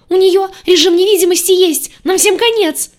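high, loud hysterical squealing; panicked shriek. A narrator's reading. Green one warns of her invisibility, panicked shriek. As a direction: shriek it as if doomed. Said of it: "взвизгнул зелёный".

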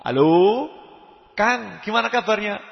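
acted friendly call opener; phone caller. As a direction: hail an older brother on the phone. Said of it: "sekedar ngomong".

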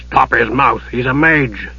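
lightly voiced gruff ruffian. A narrator's reading. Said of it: "came a gruff voice".

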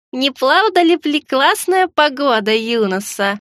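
slow, high mumbling as if losing dentures; toothless old woman. Pretend. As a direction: lisp it like a toothless granny. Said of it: "пошамкала я".

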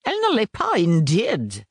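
high, loud, certain declaration; unshakable conviction. A narrator's reading. Lazarus settling the question, high and convinced. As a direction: state it as gospel truth. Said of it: "affirma Lazarus avec une inébranlable conviction".

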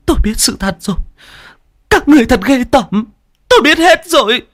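high, anguished scream climbing to the last phrase; hét lên. A narrator's reading. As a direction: scream out an accusation, full of disgust. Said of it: "mà hét lên". (gào lên tố cáo đầy căm phẫn)

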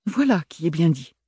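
prim, tight-lipped approval; a lightly voiced prudish lady approving; prim older sister approving, pinched. A narrator's reading. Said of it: "d'un ton pincé".

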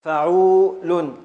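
فعول drawn out slowly and loudly, syllable by syllable; slow loud scansion. Shown as a demonstration. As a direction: chant فعول out to mark the meter. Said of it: "حركة، حركة، سكون".

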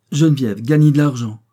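scandalised shout; exclaiming. A narrator's reading. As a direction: cry out in disbelief. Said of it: "s'écria Victoire".

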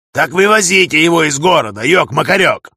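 loud, high, impatient command; exasperated shouted order; barked order. Acted. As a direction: bark it down the radio. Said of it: "рявкнул Егор Михайлович".

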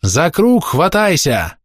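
high, slow shout over the storm. A narrator's reading. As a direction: yell it across the water. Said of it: "кричала с катера".

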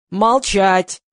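loud one-word bark; forceful, loud command; barked order. A narrator's reading. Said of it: "гаркнул Гриша".

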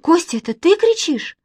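put-on high, panicky little girl; high-pitched, wailing questions; frightened cry. A narrator's reading. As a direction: call anxiously through the dark. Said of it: "закричала Манечка".

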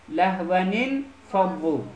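"لهونین فبو" slowly as lahwanin-fadhu; slow, linked model reading of "لهونین فبو"; nun given kasra. Shown as a demonstration. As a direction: draw out "لهونین" with an i after the first n.

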